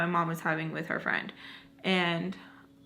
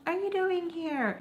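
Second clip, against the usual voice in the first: muted mock scream; 9 semitones higher than usual. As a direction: mimic distant screaming without raising the volume. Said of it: "You can hear screaming of".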